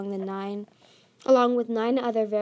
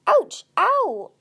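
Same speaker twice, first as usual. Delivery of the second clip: two very high, loud yelps; yelps of pain; 11 semitones higher, 9 dB louder than usual. A narrator's reading. Dragon pinned down and tied, yelping in pain. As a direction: yelp as if suddenly hurt. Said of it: "Clay yelped".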